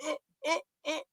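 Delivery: high, quiet choking squeaks; acted choking noises; mock choking. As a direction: imitate gagging in small high gasps. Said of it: "choked, like, she was getting choked to death".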